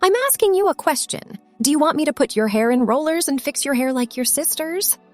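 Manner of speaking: high, pointed questioning; sharp insistence. A narrator's reading. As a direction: press the child for an answer. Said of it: "louder than normal and sharper".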